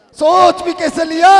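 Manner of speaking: scornful shout. Acted. Elder scolds Bahubali for fighting his brother, shouting. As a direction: hurl लिया up as an outraged question. Rebuke then repeated louder.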